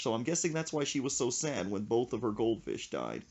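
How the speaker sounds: ordinary talk, nothing marked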